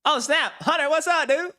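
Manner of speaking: loud, high surprised greeting; high-pitched hype shout-out; excited shout. Real, not acted. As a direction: holler hello to a buddy who just showed up. Greeting a viewer who arrives.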